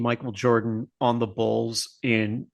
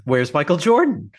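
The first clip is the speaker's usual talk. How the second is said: calls it out loud and high, half joking; mock shout; 5 semitones higher, 6 dB louder than usual. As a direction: bellow the question like a fan in the stands. Mock echo of the fan question.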